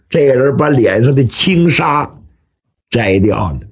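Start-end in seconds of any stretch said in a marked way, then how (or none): none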